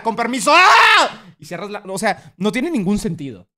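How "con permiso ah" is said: high, loud startled scream cutting off a greeting; mock fright. Streamer imagining himself scared of doors, hammed-up loud shriek. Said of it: "cómo entras a un lugar?".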